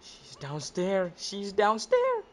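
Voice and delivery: slow, rising nervous alarm; mounting dread after a gasp. Real, not acted. Spots Granny downstairs in game.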